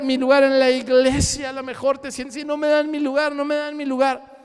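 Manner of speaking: ordinary talk, nothing marked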